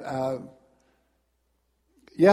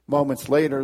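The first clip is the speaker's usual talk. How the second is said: ordinary talk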